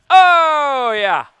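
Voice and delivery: huge, high celebratory cheer; excited shout. Real, not acted. Player cheers a big hit, excited shout.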